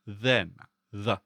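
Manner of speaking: "then" and "the" with a buzzed, voiced TH. Shown as a demonstration. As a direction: model the voiced th in "then", then alone as "the". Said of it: "corresponds to its voice counterpart".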